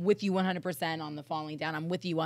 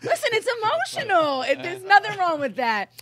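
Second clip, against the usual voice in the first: 1 cooing; 10 semitones higher, 6 dB louder than usual. Real, not acted. Comforting teasingly.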